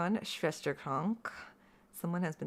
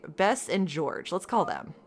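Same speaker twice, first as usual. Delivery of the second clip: ordinary talk, nothing marked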